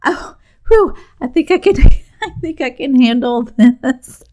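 high, loud burst of relief with a laugh; sudden relief after a scare, high and loud; relieved. Retold or imitated. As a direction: let all the worry out at once. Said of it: "And I'm like".

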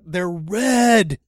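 high, very loud exasperated answer; retold screaming. Fighter answering the driver by phone, screaming. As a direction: shout it back like the fed-up caller. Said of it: "He's screaming at me".

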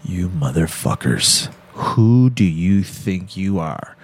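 low, slow mock lawman growl; tough sheriff. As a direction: lean in like a menacing small-town sheriff. Acted quote, sheriff scolding.

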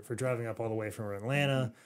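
ordinary talk

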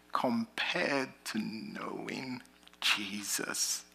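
slow, soft whispered close. Acted. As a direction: whisper it slowly like a prayer. Whispered closing blessing.

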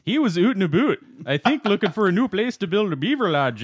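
a hint of a Canadian accent, "ootin' a boot" for "out and about"; lightly voiced Canadian accent, "ootin' a boot". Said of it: "Canadian accent, by the way".

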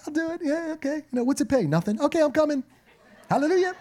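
high, quick, eager self-imitation; eager yes. Young worship leader jumping at an unpaid gig, high and eager. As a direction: overeager young musician agreeing to play for nothing, high and eager.

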